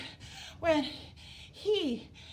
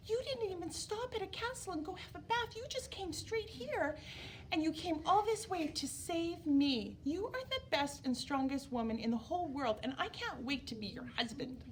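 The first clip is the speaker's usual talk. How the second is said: softer, higher, adoring prince voice; mock sweet prince; 5 semitones higher, 4 dB quieter than usual. A prince softly sweet-talking the princess, adoring. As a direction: gush gently like a smitten prince. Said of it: "he did not say that".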